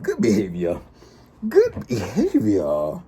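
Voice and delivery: drawn out, slow Good behavior; slow smug repeat; mock righteous. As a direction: savor it like a smug promise.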